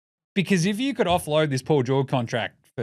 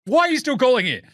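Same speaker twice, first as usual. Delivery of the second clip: annoyed yell; 6 semitones higher, 3 dB louder than usual. Retold or imitated.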